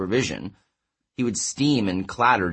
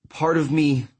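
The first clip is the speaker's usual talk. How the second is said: faltering voice; 6 semitones higher than usual. A narrator's reading. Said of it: "his voice faltered".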